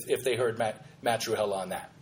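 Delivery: usual speaking voice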